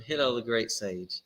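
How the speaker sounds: ordinary delivery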